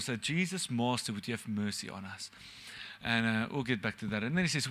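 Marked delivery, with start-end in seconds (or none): 0.2-2.3 prayerful. Shown as a demonstration. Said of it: "good phrase for us to pray".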